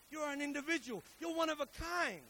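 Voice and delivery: loud, high declaration; forceful sermon cry; preaching shout. Real, not acted. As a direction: proclaim it to the crowd.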